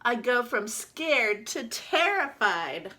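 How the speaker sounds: lively storybook reading as the duck; reading to children. Acted. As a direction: read it out to the class like a picture book. Teacher reads picture book aloud.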